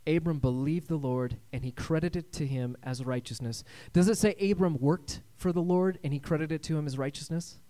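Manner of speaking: normal talking voice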